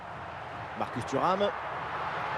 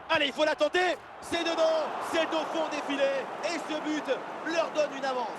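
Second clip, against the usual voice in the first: excited sports commentary; 9 semitones higher than usual. Real, not acted. Goal call by commentator.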